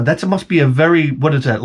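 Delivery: normal talking voice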